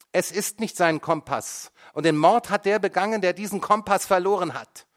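high, loud, gloating declaration; triumphant. A narrator's reading. Mother turns to the judge, triumphant and loud. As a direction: win the point with glee.

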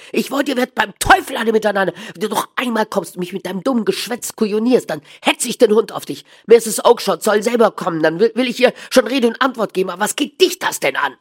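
high, loud, fast-tongued rage; angry shouting. A narrator's reading. Dealer threatening to set the dog on him, shouting angrily. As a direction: bawl out the pestering visitor. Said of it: "schrie er dabei".

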